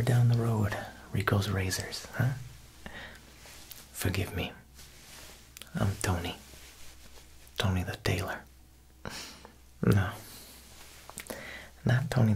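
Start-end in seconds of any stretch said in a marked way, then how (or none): none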